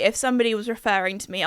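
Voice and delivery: ordinary delivery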